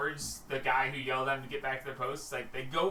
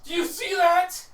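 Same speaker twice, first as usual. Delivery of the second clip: high, loud, drawn-out guard call; 11 dB louder than usual. Acted. Guard on the wall calls to his partner, loud and high. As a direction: call it out to the others, alarmed.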